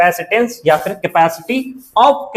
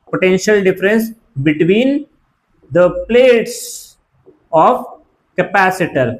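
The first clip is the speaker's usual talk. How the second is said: slow dictation, pausing between phrases; measured teacher dictation with long gaps; dictating; much slower than usual. Real, not acted. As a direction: spell it out phrase by phrase for note-takers.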